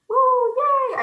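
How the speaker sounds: high, loud woo yay; mock party cheer. Closing the hostess's party, high loud cheer. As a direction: cheer like the party just wrapped.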